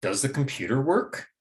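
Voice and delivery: yes-no question climbing on WORK; rising question intonation. Shown as a demonstration. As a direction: lift "work" at the end to show doubt. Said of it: "when we use that rising pattern".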